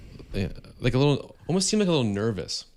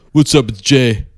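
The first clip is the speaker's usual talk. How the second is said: imitating Jason Momoa; 3 semitones higher, 15 dB louder than usual. Jason Momoa calling the shop owner, loud mock greeting. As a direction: boom out a laid-back hey it's me.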